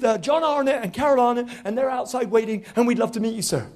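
breathless-sounding rush of a starstruck fan; talking nonstop. Retold or imitated. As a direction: race through it so nobody can cut in. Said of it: "talking at a hundred miles an hour".

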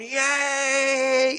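high, long-held "yay"; imitated crowd cheer. Crowd cheers the supposed winner, high mock cheer. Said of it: "all these people are sh".

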